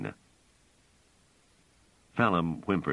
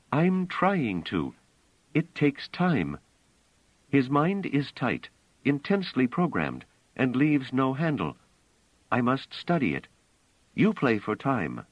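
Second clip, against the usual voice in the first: higher, lightly voiced young woman; Bliss; 8 semitones higher than usual. A narrator's reading. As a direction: speak a little higher as the woman.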